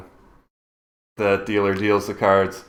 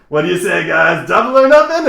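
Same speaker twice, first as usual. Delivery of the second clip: rising, louder hype call; rousing the crowd; 8 semitones higher, 6 dB louder than usual. Acted. Gambler hyping the crowd for double or nothing, rousing. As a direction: work the crowd up. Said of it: "looks back at the crowd and goes".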